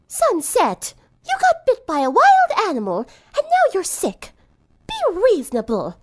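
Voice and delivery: high-pitched concerned friend scolding gently; worried friend. Acted. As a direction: voice her high and pleading, reasoning with a sick friend.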